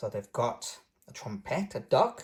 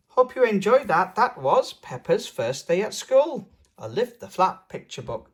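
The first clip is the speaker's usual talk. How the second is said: higher, louder cheerful signoff; upbeat outro; 6 semitones higher, 7 dB louder than usual. Real, not acted. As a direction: wrap up brightly for viewers. Reader outro to viewers.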